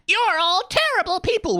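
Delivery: put-on high-pitched bratty kid voice; mock a kid's shrill outburst; shitty kid. Kid telling off the whole cast, high mocking kid voice. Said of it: "just this shitty kid being like".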